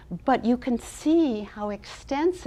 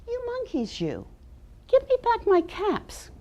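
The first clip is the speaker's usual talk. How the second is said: scolding peddler; 12 semitones higher than usual. Acted. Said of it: "and said".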